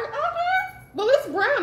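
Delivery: high, clueless voice of her younger self; naive younger self. Retold or imitated. Retelling her first fried chicken, mock naive. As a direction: sure the chicken is done because it's brown. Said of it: "So I was like".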